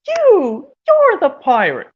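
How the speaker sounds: high, loud accusing cry; cartoon accusation. Cartoon character telling the captain he is the pirate, accusing. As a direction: point him out at the top of your voice.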